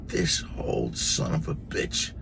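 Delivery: low, slow, whispered aside; whispered insult. Real, not acted. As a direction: hiss it under your breath, slowly. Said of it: "aside about the principal".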